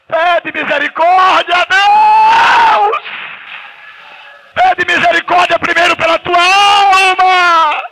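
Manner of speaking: very high, loud, slow drawn-out preacher cry; revival shout. Real, not acted. Preacher crying out with the congregation for mercy, very high and loud. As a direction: wail it to heaven, pleading for revival.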